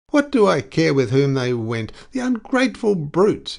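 indignant cry at the deserters; angry exclamation. A narrator's reading. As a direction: spit out the outrage. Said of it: "exclaimed the other".

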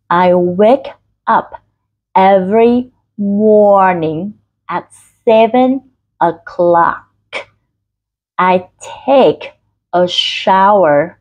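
very slow, over-articulated drill reading; slow, word by word. Shown as a demonstration. Teacher has learners feel lips and jaw move, slow exaggerated articulation. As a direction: stretch each word with big mouth movement. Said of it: "Các bạn đọc chậm cho mình từng từ".

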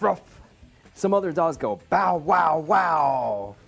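put-on reading to children. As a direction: read it like bedtime for a toddler. Reads children's book aloud.

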